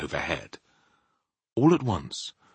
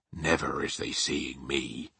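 slow, low, assured giant; confident; 5 semitones lower, 7 dB quieter than usual. A narrator's reading. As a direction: answer softly and slowly, quite sure of yourself. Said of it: "the B F G said confidently".

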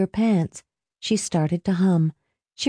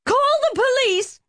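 very high-pitched shriek. A narrator's reading. Helen jerking back from Marie, shrieking. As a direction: shriek for help, pitched right up. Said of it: "Helen began to shriek".

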